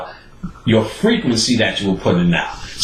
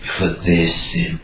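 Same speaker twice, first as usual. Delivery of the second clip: solemn aunt; 4 semitones lower, slower than usual. Retold or imitated. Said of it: "My aunt pulls me to the side".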